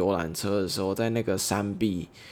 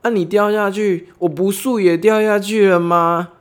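driver laughing it off; 10 semitones higher, 9 dB louder than usual. Retold or imitated. Truck driver reassures the nervous hitchhiker, high and jovial. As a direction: play the driver brushing off the fear with a grin. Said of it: "他笑着说".

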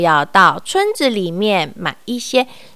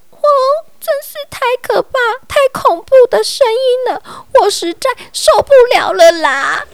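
much higher, fast panicked cry; frightened clamor while running; 12 semitones higher, faster than usual. A narrator's reading. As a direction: wail it while fleeing the noise. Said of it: "他边跑边嚷嚷".

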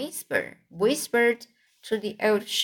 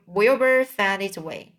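ordinary delivery

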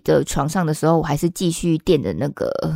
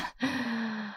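soft laugh; 6 semitones higher, 10 dB quieter than usual. Real, not acted. Laughs at own story.

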